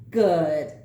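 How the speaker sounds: low, loud cackled word; cackling creature. A narrator's reading. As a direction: cackle it like a sly little witch. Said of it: "cackled the small creature".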